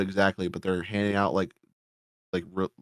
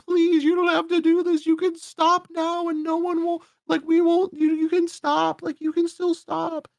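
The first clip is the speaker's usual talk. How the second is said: high, whiny mock plea; mock pleading victim. Mocking the victim begging her killer, high and whiny. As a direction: squeal a hammed-up begging voice, much higher. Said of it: "And she's like".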